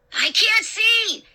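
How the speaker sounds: high, drawn-out panicked cry; slow, high-pitched frightened shout. A narrator's reading. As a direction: shout it out in alarm, stretching the words. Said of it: "Luke shouted".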